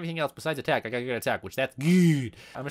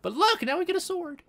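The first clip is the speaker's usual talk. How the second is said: usual speaking voice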